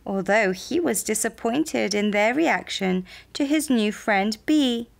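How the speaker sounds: usual speaking voice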